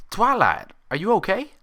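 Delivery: high, loud worried pony cry; high startled pony voice; alarmed Pinkie. A narrator's reading. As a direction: call out in sudden concern.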